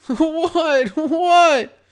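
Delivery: high, loud, panicked squeal; terrified. A narrator's reading. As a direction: squeak it out in terror. Terrified victim begging.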